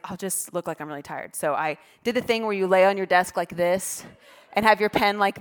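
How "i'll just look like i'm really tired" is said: soft scheming; quietly plan the trick; scheming inner voice. Retold or imitated. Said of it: "I was like".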